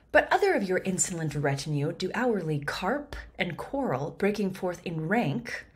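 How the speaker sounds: measured verse reading; Shakespeare reading. Shown as a demonstration. Reads Goneril's lines aloud.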